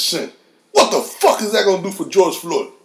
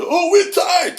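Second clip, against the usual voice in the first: mocking whine; 11 semitones higher, much slower than usual. Mocking older men's excuse.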